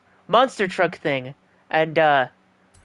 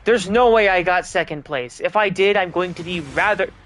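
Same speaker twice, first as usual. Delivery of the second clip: usual speaking voice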